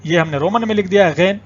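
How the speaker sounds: usual speaking voice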